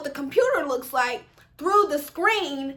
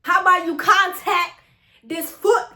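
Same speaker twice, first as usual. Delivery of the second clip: loud, raised-pitch mock threat; irate comeback; 5 semitones higher, 7 dB louder than usual. Student answering the proctor's useless advice, loud irate comeback. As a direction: throw it back at full volume.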